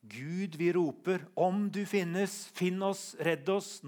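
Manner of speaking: loud, high, desperate plea; crying out to God. Acted. Preacher reading a lament verse to God, crying out. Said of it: "i første vers så sier han".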